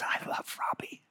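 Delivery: mock-tearful, hushed whisper; tearful tenderness. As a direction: whisper it as if moved to tears. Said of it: "tears begin to flow down his face".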